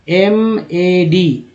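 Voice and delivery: M A D letter by letter, slowly; slow spelling out. Shown as a demonstration. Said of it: "अब यहाँ पर ए बोलने लगेंगे". (धीरे-धीरे अक्षर बोलना)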